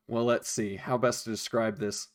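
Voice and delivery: ordinary talk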